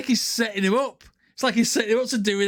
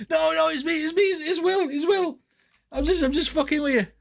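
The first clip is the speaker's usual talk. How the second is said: higher, pleading prankster owning up; a hammed-up squeaky "it's me, it's Will"; mock panicky plea; 8 semitones higher than usual. Prankster pleading with angry campers.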